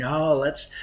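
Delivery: playfully hammed-up slumber-party eagerness; excited girls. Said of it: "and they were excited".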